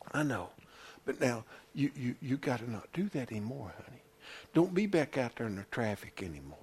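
soft, low, gentle admonishing; soothe, then quietly caution a child; tender fatherly voice. Acted. God as father to a fallen child, soft and tender. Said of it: "pick me up or you up and say".

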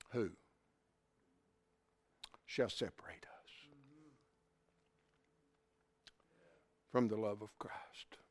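soft whispered scripture. Real, not acted. Preacher whispering the verse to his church. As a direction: breathe the verse out, barely voiced.

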